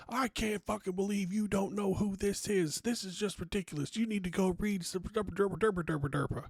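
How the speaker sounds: high, mock fanboy rant; squeaky high-pitched mockery of a gatekeeping fan; mocking outraged superfan. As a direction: play the offended fan who can't believe you don't know. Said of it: "I just got blown up".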